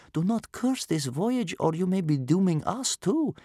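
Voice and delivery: put-on high child's voice, unhurried; cautioning small boy. A narrator's reading. Captive boy warns cursing grandmother, cautioning.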